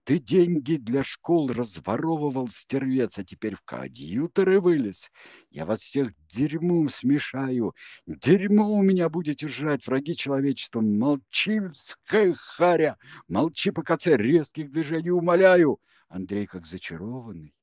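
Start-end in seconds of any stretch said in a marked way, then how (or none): 0.0-14.2 higher, rising angry tirade; a touch of frenzied raving; frenzied shouting. A narrator's reading. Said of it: "неистово кричал Кенси". 14.2-15.8 high, loud mock pleading; hammed-up imitation of a plea; mocking echo. A narrator's reading. Kensi mockingly parrots Izya.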